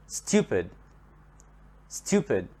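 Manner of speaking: "stupid" with STU stressed, falling after; stress at the beginning. Shown as a demonstration. Teacher shows learners the adjective, first syllable of "stupid" stressed. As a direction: lean on the start of "stupid": STU-pid. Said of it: "the stress here is at the beginning".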